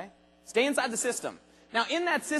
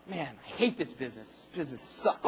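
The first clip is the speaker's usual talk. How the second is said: discouraged grumble; 9 semitones lower, 9 dB quieter than usual. Retold or imitated. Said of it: "You keep flipping the cards".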